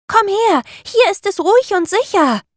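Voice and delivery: hoch und laut herüberrufen; laut rufen. A narrator's reading. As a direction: ruf den Papagei zu dir herüber. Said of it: "rief der kleine Affe". (hoher lauter Ruf)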